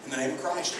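soft, hushed thanks to God on waking; quiet morning prayer. Shown as a demonstration. Preacher demonstrates a waking prayer for his church, hushed and reverent. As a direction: pray it gently before your feet touch the floor. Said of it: "stop and say".

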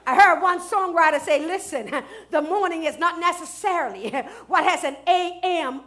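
fervent preacher's cadence, loud and ringing over music; preaching. Acted. Pastor preaching to his congregation, full force.

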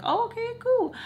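very high, singsongy acknowledgement; put-on agreeable. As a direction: mimic a polite okay way up high. Retells her reaction to friend buying.

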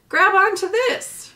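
high and loud, slow; urgent call. Acted. Robot shouting to the beaver in the pond. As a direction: call out over the splashing.

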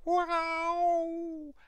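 high drawn-out meow said as wow; cat meow. Acted. Storyteller meows for Frankie the cat, high and drawn out. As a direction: mew like a cat, high and long. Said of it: "Frankie gave a meow".

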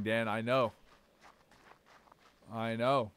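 ordinary talk, nothing marked

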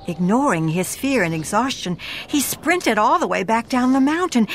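usual speaking voice

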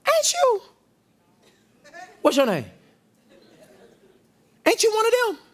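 high, pointed accusation; mock accuser. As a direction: jab the question up high. Bystander accusing Peter.

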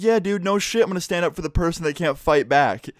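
high-pitched, indignant comeback; heated retort. Retold or imitated. Student defends teacher against a classmate, heated retort. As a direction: fire back in defence of someone weaker.

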